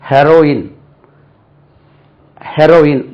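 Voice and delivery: ஹெரோயின் as "HEH-ro-in", short e; correct pronunciation. Shown as a demonstration. Teacher gives one sound for both words, short-e model pronunciation. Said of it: "ரெண்டுமே ஒரே உச்சரிப்பு தான்".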